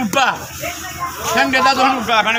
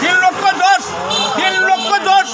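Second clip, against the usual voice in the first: auctioneer; 5 semitones higher, slower than usual. Acted. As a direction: call out the bids like an auctioneer. Auctioneer repeats bid.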